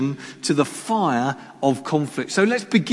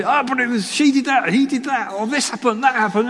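high, hurried squabbling excuses; mock protesting; 7 semitones higher than usual. Preacher mimicking people blaming others in strife, high protesting voice. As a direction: imitate people passing the blame.

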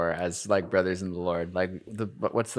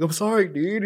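mock emotional apology; 12 semitones higher, 4 dB louder than usual. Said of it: "I'm getting emotional".

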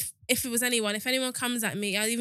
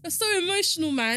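higher, louder mock of a dismissive boyfriend; mock dismissive guy; 6 semitones higher, 4 dB louder than usual. Mocking a boyfriend who dismisses feelings, higher and louder. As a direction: sneer it like a careless guy. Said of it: "Imagine someone being".

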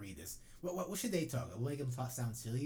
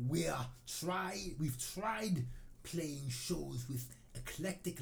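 deliberate, mock-serious Norwegian accent; fake Norwegian accent; 3 semitones higher than usual. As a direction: build to grand menace in a fake Norwegian accent. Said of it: "make a fake Norwegian accent".

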